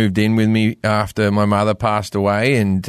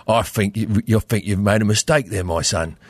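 hard-man Cockney accent, a bit lower at the end; Jason Statham Cockney; 3 semitones lower than usual. Shown as a demonstration. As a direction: do a tough London Cockney. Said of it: "You just do a Jason Stratham, you make it hard as fuck".

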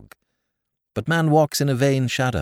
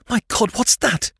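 startled; 12 semitones higher than usual. Acted. Startled by shrieks in dark.

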